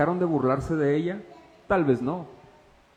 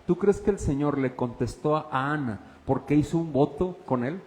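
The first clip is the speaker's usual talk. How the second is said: normal talking voice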